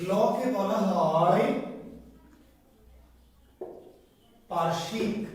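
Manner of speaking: "লকে বলা হয়" drawn out, long pause, then "পার্শ্বিক"; slow dictation. Shown as a demonstration. Dictation for students to write down.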